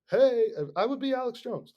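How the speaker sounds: loud, high demonstrative holler; shouting with conviction. Acted. Host shows how he'd warn people, shouting. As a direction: shout it like an alarmist radio ranter. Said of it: "if he was up there shouting".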